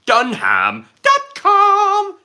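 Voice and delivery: high, slow squeaky puppet voice; Peanut puppet voice. Retold or imitated. Streamer quotes a ventriloquist puppet for chat, squeaky puppet voice. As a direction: do the wacky puppet's catchphrase. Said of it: "I could just quote Peanut like that".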